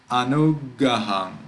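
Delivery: anugaham run together with a brief hold on the g; short double consonant. Shown as a demonstration. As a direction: keep the doubled g of anugaham but read it through. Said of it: "So if we read fast".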